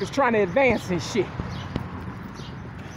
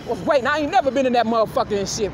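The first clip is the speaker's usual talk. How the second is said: ordinary talk, nothing marked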